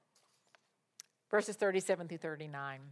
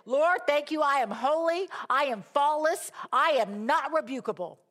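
raised, loud prayer of thanks; exultant declaration; 8 semitones higher, 7 dB louder than usual. Retold or imitated. Believer answering the Lord after reading the verse, exultant declaration. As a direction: declare each claim triumphantly to God. Acts out her joyful prayer response.

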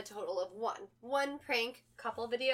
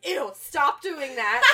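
loud, slow, grossed-out protest; mock disgust; 8 dB louder, slower than usual. Boyfriend watching her peel glue off, mock disgusted protest. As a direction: recoil loudly, close to laughing.

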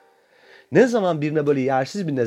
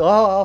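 mocking gasp; 5 semitones higher, 7 dB louder than usual. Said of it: "falan diye böyle".

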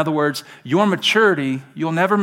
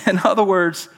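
normal talking voice

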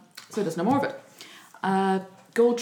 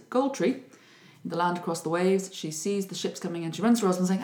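ordinary delivery

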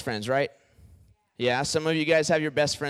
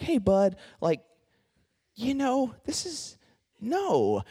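higher, halting mock protest; awkward objection; 7 semitones higher, slower than usual. Imitating Peter trying to correct his friend, awkward and halting. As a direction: hesitate awkwardly, pitched up.

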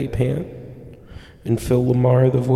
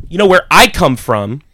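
indignant; 3 semitones higher, 7 dB louder than usual. Retold or imitated. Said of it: "I said".

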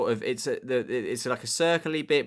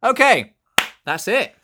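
1 hammed-up wrap-up; 12 semitones higher, 10 dB louder than usual. Real, not acted. Wrapping up the show.

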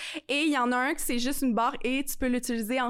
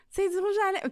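daughter voice; 8 semitones higher, faster than usual. Retold or imitated. Daughter voiced finding lipstick.

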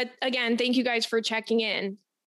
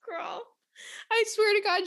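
laughing it out, then much higher; 9 semitones higher than usual. Real, not acted. Telling listeners mom's mix-up while laughing. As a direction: still giggling as the words come out.

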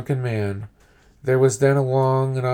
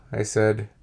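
ordinary delivery, nothing marked

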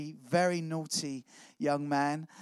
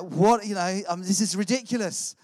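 loud, higher, exasperated disbelief; incredulous; 3 semitones higher, 6 dB louder than usual. Retold or imitated. Newcomer reacting to strict churchgoers, loudly incredulous. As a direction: blurt it out in amused outrage.

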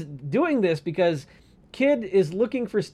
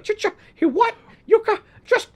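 much higher laughing stammer; Wesley impression; 5 dB louder than usual. Wesley reacting to the letter, high and laughing. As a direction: splutter in disbelief through laughter. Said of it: "Be like".

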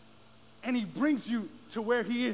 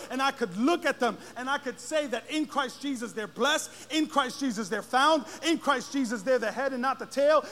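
ordinary delivery

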